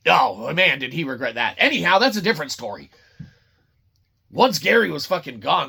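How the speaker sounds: usual speaking voice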